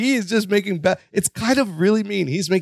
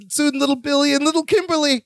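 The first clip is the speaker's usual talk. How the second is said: high imitating Larry; 7 semitones higher than usual. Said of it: "he says".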